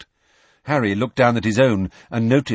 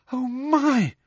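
high, shocked gasp; 13 semitones higher than usual. A narrator's reading. Student watching the fight from the tower, shocked gasp. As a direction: gasp the words in horror. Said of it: "gasped Hermione".

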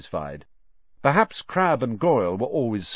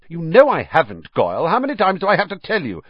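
higher, louder and fast, irritated; snapped; 10 semitones higher, 6 dB louder, faster than usual. Acted. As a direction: bite his head off for asking again. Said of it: "snapped Malfoy".

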